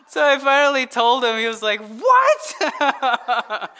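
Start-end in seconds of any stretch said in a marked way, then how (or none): 1.8-3.7 high-pitched imitated shock; hammed-up astonished yelp; mock disbelief. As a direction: imitate a friend's stunned outcry. Retold friend learning of the prank.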